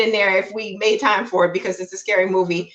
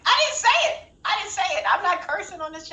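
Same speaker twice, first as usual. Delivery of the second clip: protesting denial; 8 semitones higher, 5 dB quieter, faster than usual. Real, not acted. Playful denial of having cursed.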